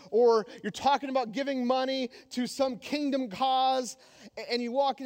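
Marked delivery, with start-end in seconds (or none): none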